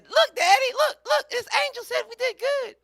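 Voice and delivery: very high mock-female falsetto; excited wife impression. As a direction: squeal it like an overexcited girl. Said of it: "she said".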